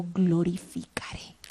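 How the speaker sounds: hushed whisper of God's answer. Acted. Preacher quoting the heavenly voice to the church, whispered. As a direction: breathe the promise out softly.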